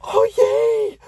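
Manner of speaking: put-on very high, loud little-girl "yay"; excited squeal. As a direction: squeal it like a thrilled kid. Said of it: "So I'm like".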